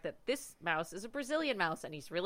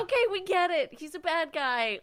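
exasperated mock; 8 semitones higher, 4 dB louder than usual. Mocking the movie's heavy hand.

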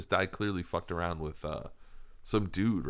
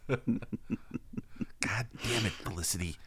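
William H. Macy impression; 2 semitones higher, 5 dB quieter, much slower than usual. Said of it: "I'm William H. Macy".

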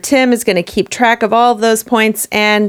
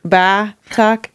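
put-on questioning Boston accent, "Ba Talk"; Texan trying a Boston accent. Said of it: "a Texan trying to do a Boston accent".

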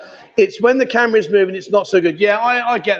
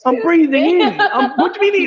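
laughing; 7 semitones higher than usual. Real, not acted. Joking about sucking belly in.

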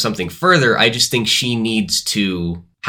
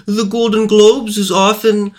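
put-on German accent; 12 semitones higher than usual. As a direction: quote them in a German accent. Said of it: "why'd you say that like a German, with a German accent".

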